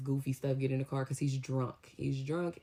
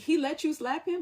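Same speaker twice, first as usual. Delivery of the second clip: high, incredulous rising question; can't believe what she's hearing; disbelieving question; 13 semitones higher, 4 dB louder than usual. Retold or imitated. Mom reacts to the slap confession, high and incredulous.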